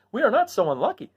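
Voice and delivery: high, light Scarecrow voice. Acted. Scarecrow cheering his friends in the throne room. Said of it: "said the new ruler".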